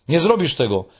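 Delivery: put-on a child refusing in outrage; wzburzony wybuch; indignant outburst. A narrator's reading. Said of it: "wybuchnął Muminek wzburzony".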